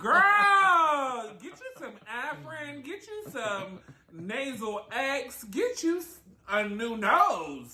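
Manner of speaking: high, slow mock nasal voice; nasally voice. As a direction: exaggerate a stuffy nasal whine. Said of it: "The nasally voice".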